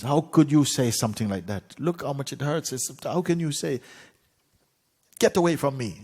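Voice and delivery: high, loud indignant outburst; acted royal fury, pitched well up; very angry. A narrator's reading. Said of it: "He was very angry".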